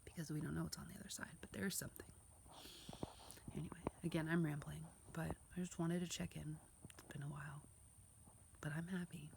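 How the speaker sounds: hushed, mostly whispered confession; whispering. Real, not acted. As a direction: whisper it like a tired secret.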